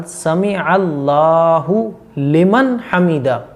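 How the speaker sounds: समी अल्लाहु very slow with a clear ऐन; slow, clear Sami'Allahu. Shown as a demonstration. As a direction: model समी with a careful ayn. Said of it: "ऐन लाम ज़बर अल". (समी अल्लाहु धीरे, 'ऐन' साफ़)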